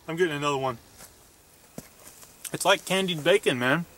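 chewing while talking; talking between bites; mouth full. Real, not acted. Seen 1: Holds bitten treat, chews and bites between lines on camera.

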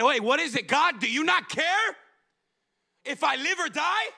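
panicked disciples. Retold or imitated. Disciples demanding an answer from God, desperate plea. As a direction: plead like you're about to drown.

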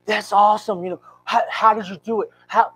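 high, loud, eager questions; excited questioning. Retold or imitated. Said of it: "I was so excited".

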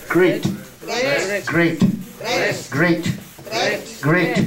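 "Great" said clearly as GRAYT, repeated for the class; teacher repeating "Great" for students; model pronunciation. Shown as a demonstration. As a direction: drill the word "Great" slowly and clearly. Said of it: "đọc chữ great với tôi nè".

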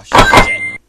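very high, loud shriek; high loud startled shriek; shrieking surprise. Real, not acted. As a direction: squeal in alarm. Panicked gamer.

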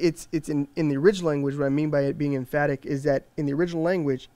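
normal talking voice

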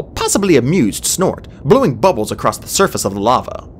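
ordinary delivery, nothing marked

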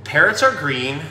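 gentle, measured picture-book narration with pauses for the pages; storybook reading. Acted. Shop owner reads to young viewers in storybook voice. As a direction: read it like story time for kids.

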